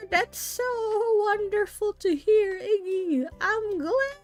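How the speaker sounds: put-on high, wobbly old grandma voice; a sweet grandmother, slightly cracking. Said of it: "I'm losing my grandma voice".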